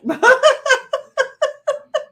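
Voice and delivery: a high-pitched hammed-up cackle; imitating a giggling streamer; high mock laugh. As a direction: burst into a silly high laugh. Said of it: "he's all like".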